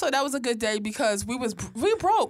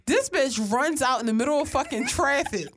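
usual speaking voice

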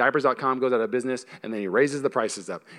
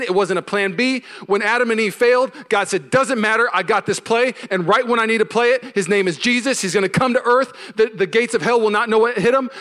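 louder, higher, driving sermon delivery; building sermon climax; full-force preaching; 7 semitones higher, 4 dB louder than usual. Acted. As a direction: preach it hard to the room.

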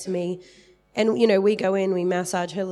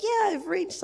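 playful victory cry, pitched much higher; mock triumphant kid; 11 semitones higher than usual. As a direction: squeal it like a kid who made the height line. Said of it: "I had this picture of me like".

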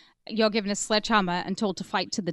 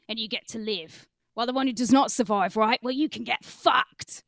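London hard man, slowing at the end; Cockney accent. Pretend. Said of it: "this is actually be good in Cockney".

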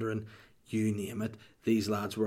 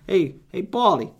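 mafioso greeting; 6 semitones higher, 6 dB louder than usual. Acted. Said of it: "he makes a quack when he talks".

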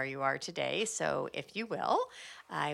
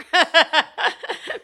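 big high laugh bursting out; loud burst of laughter; 12 semitones higher, 15 dB louder than usual. Real, not acted. Host laughing at her own realtor joke, loud laugh.

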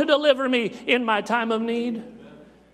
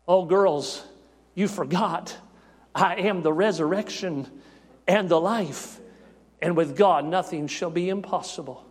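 gentle comfort; 7 semitones lower, 3 dB quieter than usual. Acted. Jesus comforts the grieving sisters.

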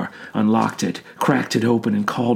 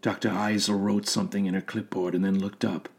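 ordinary delivery

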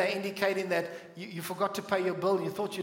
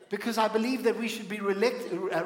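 ordinary delivery

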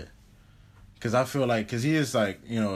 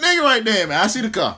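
loud, high pretend alarm with laughter; jokey panicked sighting, pitched way up; mock panic; 9 semitones higher, 7 dB louder than usual. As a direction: warn like a spooked lookout, hammed up. Acts out spotting the stalker's car.

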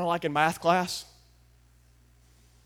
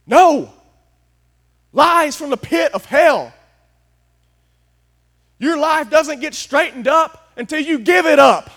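louder and higher, slow and forceful; fiery preaching; 10 semitones higher, 11 dB louder, slower than usual. Real, not acted. Pastor rejecting works-based salvation, loud preaching. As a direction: thunder it from the pulpit. Preacher rebuking false belief.

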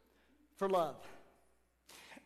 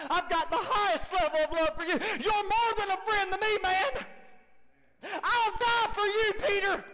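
high, loud impassioned plea; Jesus pleading; 7 semitones higher, 4 dB louder than usual. Acted. Jesus declaring he will die for Peter. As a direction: pour out the love, rising.

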